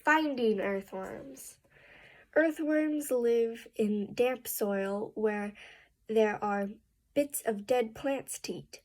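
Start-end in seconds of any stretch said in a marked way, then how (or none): none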